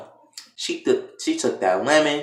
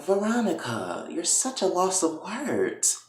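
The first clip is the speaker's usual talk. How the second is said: high, soft, drawn-out needling; mock-sweet taunt; 7 semitones higher, 6 dB quieter than usual. [name] to speechless Veronica, softly taunting. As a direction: toy with your prey in a soft high voice.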